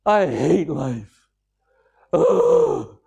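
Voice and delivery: put-on mope it out like a bored teenager; sulky teenager. Teenager flopping on the couch complaining to dad, slow and sulky. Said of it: "a teenager plops down on the couch and says".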